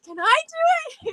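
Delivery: very high eager mock plea; high imitated eagerness; husband begging eagerly. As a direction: pitch way up like a thrilled kid. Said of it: "He's like".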